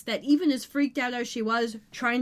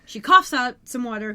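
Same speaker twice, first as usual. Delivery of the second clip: ordinary talk, nothing marked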